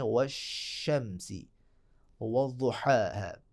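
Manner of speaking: वशम्सी said very slowly with a clear, drawn-out sh; slow teaching demo of वशम्सी; drawn-out sh sound. Shown as a demonstration. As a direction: stretch the sh in वशम्सी deliberately. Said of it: "शीन की अपने practice करनी है".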